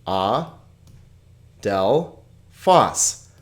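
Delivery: A, del, phos, with long gaps between; syllable by syllable. Shown as a demonstration. Teacher dividing a Greek word, A, del, phos spaced out. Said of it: "Here we have three vowel sounds".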